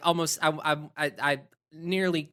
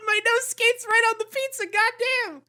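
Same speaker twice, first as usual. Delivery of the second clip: high, far-off mock dude voice, much higher; mock skater exclamation; 4 dB louder than usual. Reading her own written joke about the movie, high goofy skater voice. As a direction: squeal it like a goofy skater kid. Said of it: "I literally wrote".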